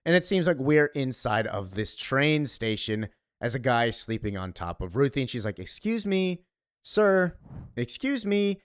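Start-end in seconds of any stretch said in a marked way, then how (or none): 5.6-8.6 high-pitched mock "excuse me" voice; polite interjection. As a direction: pipe up to get a stranger's attention, high. Acting girl addressing man sleeping on her.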